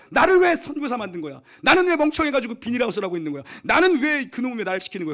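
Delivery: high, loud indignant cry to God; mock rant at God. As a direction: shout your complaint to heaven. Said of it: "원망하고요".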